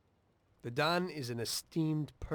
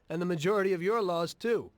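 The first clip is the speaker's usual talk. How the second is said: louder, higher and faster, driving the point home; heated retort; 5 semitones higher, 5 dB louder than usual. Acted. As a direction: jab it back at him. Mobster argues back heatedly.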